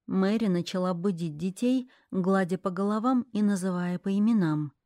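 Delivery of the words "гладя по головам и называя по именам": ordinary delivery, nothing marked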